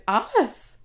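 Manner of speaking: retold stunned query, rising at the end; disbelieving question. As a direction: echo the name as a disbelieving question. Retells hearing the wrong name.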